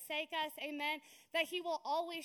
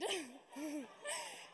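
bright laugh; 4 dB quieter than usual. Real, not acted. Retelling her own proud answer.

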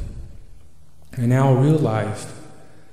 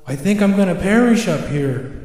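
higher-pitched, mildly plaintive owl; lamenting owl; 7 semitones higher, 4 dB louder than usual. Acted. Owl confessing to Creator from the branch, lamenting. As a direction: complain with the pitch lifted.

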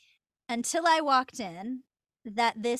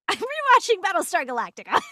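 high, loud fan gush with a laugh; giddy, raised-voice announcement; excited fan; 8 semitones higher, 10 dB louder, faster than usual. Retold or imitated. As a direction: re-enact bursting out with geeky excitement. Retelling own gushing to director.

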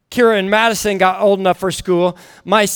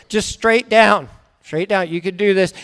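lower, measured reassurance; coaching; 4 semitones lower than usual. Retold or imitated. Father talking crying daughter down, calmly coaching. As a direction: guide the panicking child down calmly. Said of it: "I coach them all the way".

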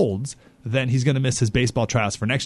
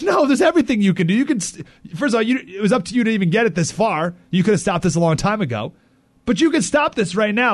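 retold pushback; 7 semitones higher than usual. Host retelling what he told the principal, insistent and raised. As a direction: push back hard at the principal. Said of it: "I'm like".

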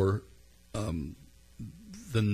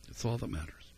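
quiet echo; 3 semitones higher, 8 dB quieter than usual. Real, not acted. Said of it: "quiet echo of the quote".

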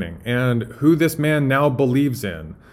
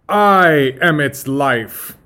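loud, drawn-out grand proclamation; Doctor Frankenstein; 3 semitones higher, 7 dB louder, much slower than usual. Acted. Doctor proclaims himself to Igor, slow and grandiose. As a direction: declare it like a megalomaniac scientist. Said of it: "starting with Doctor Frankenstein".

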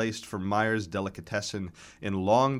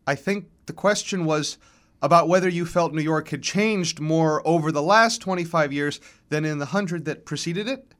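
higher-pitched, polite woman's question; careful moderator voice, pitched up; nervous moderator; 7 semitones higher, 6 dB louder than usual. A narrator's reading. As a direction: gingerly restate the question to difficult guests. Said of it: "shuffling her note cards nervously".

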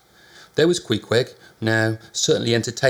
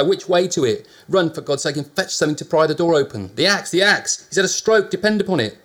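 frantic urgent orders; 7 semitones higher, 5 dB louder than usual. A narrator's reading. Urgent demand for the axe.

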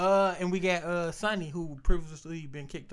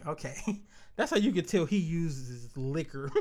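ordinary talk

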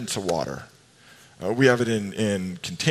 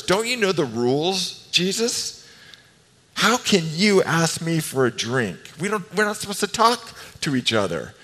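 higher, put-on voice of a startled woman; incredulous Samaritan woman; 6 semitones higher than usual. Preacher voicing the Samaritan woman to Jesus, higher and incredulous. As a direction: voice her disbelief that Jesus would ask.

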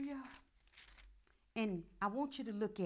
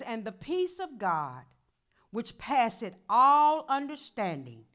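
slow, loud solemn reading; scripture reading; 11 dB louder, slower than usual. Acted. Pastor reading Philippians to the church, slow and loud. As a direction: proclaim the verse slowly.

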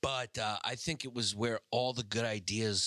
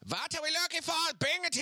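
put-on German accent. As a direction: bark it like a German villain. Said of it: "do a German accent when they scream".

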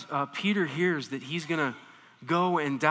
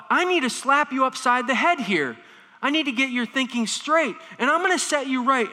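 louder, higher scolding as Peter; 6 semitones higher, 4 dB louder than usual. Retold or imitated. Peter scolding Jesus, voiced loud and higher. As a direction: set him straight with force. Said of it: "Like Peter felt".

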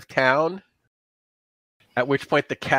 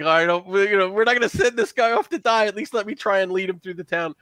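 higher, fast, earnest plea; pirate insisting; 7 semitones higher, faster than usual. Acted. Pirate insists on leading the chase, higher and fast. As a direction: rush it like a decent guy who won't let him die alone. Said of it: "And he's like".

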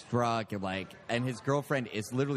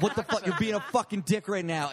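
raised pitch, mock confrontation; retold angry outburst; 6 semitones higher, 4 dB louder than usual. Angry boyfriend flips out at the storyteller, retold heatedly. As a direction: imitate someone flipping out at you. Retelling man who flipped out.

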